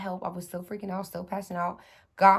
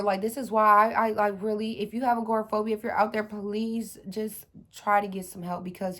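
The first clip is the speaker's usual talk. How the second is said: normal talking voice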